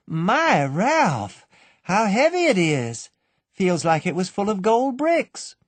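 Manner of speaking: put-on high, light, teasing girl's voice; playful young woman. A narrator's reading. Annabel teasing her sweetheart, high and playful. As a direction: show off the hat and bag with a giggle in the voice. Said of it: "said Annabel".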